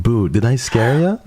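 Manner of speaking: sing-song boo to startle chat; startling boo. Retold or imitated. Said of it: "I think I know what you're talking about".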